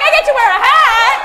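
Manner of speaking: put-on high, loud delighted kid, laughing; mock excited kid. Teacher mimics eager kids grabbing a hat, excited. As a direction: play a thrilled child getting a hat. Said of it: "Now they're like".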